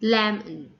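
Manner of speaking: "lemon" as LEM-un; strong "lem", schwa in the end of "lemon"; stress on first syllable. Shown as a demonstration. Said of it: "stress falls on the first syllable".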